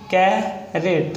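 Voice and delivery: Carat split into "kai", pause, "ret"; sounded out slowly. Shown as a demonstration. Teacher showing where the vowel falls, sounded out slowly. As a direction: say carat slowly in two beats.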